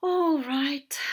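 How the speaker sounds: loud, mournful whale; sad, sighing agreement. A narrator's reading. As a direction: give in sadly, at full volume. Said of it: "Whale said sadly".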